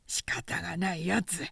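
whispered complaint; muttered grumble. Acted. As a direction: grumble under your breath. Grumbled under breath.

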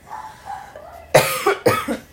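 raspy choked cough; clearing a choke; choking cough. Real, not acted. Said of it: "Engasguei com a saliva".

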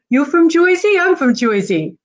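hammed-up high New Jersey accent. Host doing an old Jersey joke, Jersey accent. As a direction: ask and answer in a broad mock Jersey accent. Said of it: "it's Jersey humor for ya".

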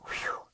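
soft exhaled whew of relief; retold sigh of relief; relieved whew. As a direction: let out a quiet, relieved breath. Relief at having changed.

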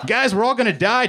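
high-pitched mock scream of doom; panicked scream. A narrator's reading. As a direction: cry out in hammed-up panic. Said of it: "Joe screamed".

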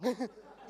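a short real laugh breaking out; quick amused laugh; burst of laughter. Real, not acted. Laugh between his remarks.

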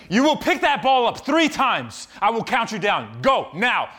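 voicing the coach's firm commands, loud. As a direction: imitate a stern trainer giving orders. Coach orders ball lifts.

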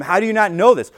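a hint of Jesus scolding; mock rebuke. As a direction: voice Jesus chiding the teacher. Jesus rebuking Nicodemus, voiced.

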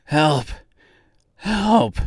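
breathless, desperate calls; gasping. A narrator's reading. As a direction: plead with what breath is left. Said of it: "he gasped".

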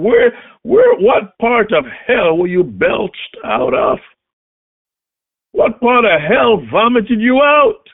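roaring with rage, slow and high; furious shout. A narrator's reading. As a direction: bellow it at the giant in fury. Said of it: "He became ballistically angry".

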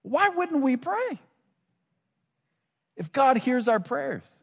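slow, high-pitched appeal; high pleading question. Real, not acted. Preacher asking congregation why not pray, high pleading. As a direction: plead it slowly, pitched well above normal.

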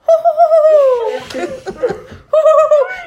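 put-on high childlike evil giggle; evil laugh. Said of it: "this is my evil laugh".

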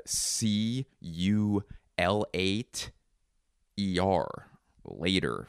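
slow, letter-by-letter spelling; spelled out slowly. Shown as a demonstration. Spelling it out to listeners who can't keep up. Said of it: "for those of you who can't keep up".

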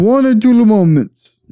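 a joke attempt at a thick Russian accent. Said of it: "Dude, that's racist".

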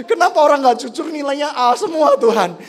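high, loud whine of injustice with laughter; mock angry protest. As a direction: protest to God in hammed-up outrage. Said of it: "saya pernah marah sama Tuhan".

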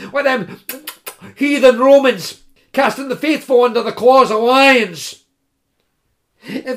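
high, loud, hammed-up pleading; pleading scholar. The students begging the old woman, high and loud. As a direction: lay the pious begging on thick.

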